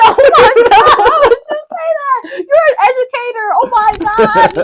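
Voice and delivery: high, loud shriek of disbelief through laughter; laughing mock outrage. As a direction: squeal in horrified laughter at the answer. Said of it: "I've never been so upset".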